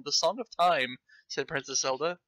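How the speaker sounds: ordinary delivery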